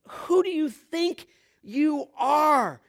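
high, slow taunting question; mocking challenge. Preacher acting out the crowd's reply to Jesus, mocking. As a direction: stretch it out and scoff at his claim. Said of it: "They're mocking him".

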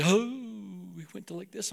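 put-on pious voice dropping to very soft; affected prayer voice. Preacher imitating young disciple at prayer, affected prayer voice. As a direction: switch into a hushed, holy praying tone. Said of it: "why you change your voice like that".

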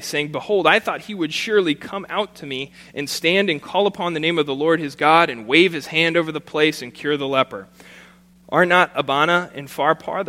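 formal public reading of scripture. Acted. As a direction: proclaim the passage to the congregation. Reads scripture passage aloud.

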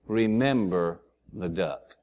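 low, slow, soft mock menace; veiled threat. [name] reminds Ray of his secret, low mock-menacing reminder. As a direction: drop it low and draw it out.

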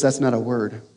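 low, soft, unbothered; a quiet, cool correction; calm and collected. Retold or imitated. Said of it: "very calmly and collectedly".

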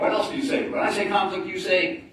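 high, loud call to the crowd; hyped crowd warm up. Acted. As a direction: fire up the room for answers. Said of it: "I wanna hear back from you".

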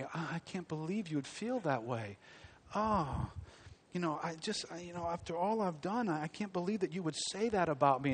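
a hint of a whiny victim; caving in. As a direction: play the hurt one lightly, fishing for sympathy. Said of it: "they cave in on themselves".

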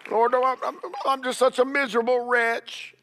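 mock miserable pleading to the Lord; whiny self-pity. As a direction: pity yourself, mockingly. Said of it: "mock self-pitying prayer".

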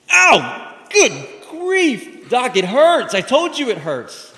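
high, loud hammed-up yelp; comic agonized shouting; mock pain. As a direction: cry out to the doctor as if jabbed. Patient touches forehead, yelps.

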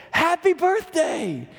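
high, loud, drawn-out cheer; surprise shout. Retold or imitated. Crowd greeting Agnes at her surprise party: loud drawn cheer.

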